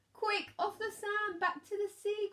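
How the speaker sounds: high, loud urgent cry of a tiny snail. A narrator's reading. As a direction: cry out in panic to the stranded whale. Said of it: "cried the snail".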